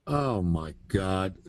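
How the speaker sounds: slow, quiet disbelief; exasperated. Acted. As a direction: sigh it out, fed up. Disbelief at the operator.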